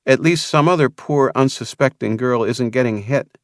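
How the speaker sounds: lightly voiced young woman; Vanessa's voice. A narrator's reading.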